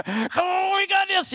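high strained sideline holler; pumped-up coach. Acted. Coach urging the team from the sidelines, amped up. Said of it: "I'm kinda like that high school coach".

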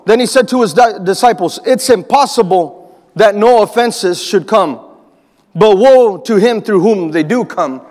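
slow, weighty reading of the verse; scripture reading. Acted. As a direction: read the verse slowly and solemnly. Reads scripture verse slowly.

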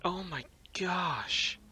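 slow, breathless whisper; scared whisper. Real, not acted. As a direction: whisper it, afraid of being heard. Hushed while hiding in game.

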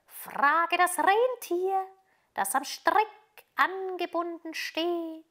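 high, slow, drawn-out pigeon voice with rolled gurr; cooing pigeons. A narrator's reading. Pigeons telling Gerda they saw little Kai, cooing. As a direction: coo the words like a pigeon.

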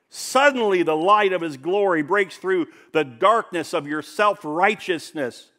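loud, high, ringing proclamation; full-force preaching. Real, not acted. Preacher booming to the congregation, loud and high. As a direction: preach it out big to the church.

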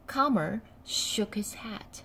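ordinary delivery, nothing marked

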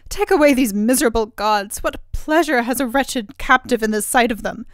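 loud, anguished outcry against her fate; despairing cry. Acted. Captive princess to the cruel queen, loud despairing cry. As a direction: fling the words out in despair. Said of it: "In despair she cried".

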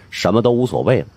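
ordinary delivery, nothing marked

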